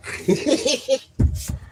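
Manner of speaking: a sudden high burst of laughter. Real, not acted. Laughing at his own story, high and real. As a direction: crack up at your own story.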